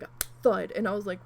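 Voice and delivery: normal talking voice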